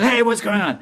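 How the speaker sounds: high, startled mock protest; high-pitched "hey!" of a tricked man; indignant shout. Acts out victim calling out.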